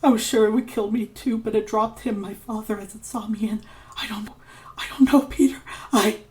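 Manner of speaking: high and frightened, sinking to a voiceless whisper; fading to a whisper. Acted. Ignatius finishing his memory to Peter, fading to a frightened whisper. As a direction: let the fear drain the voice away to breath.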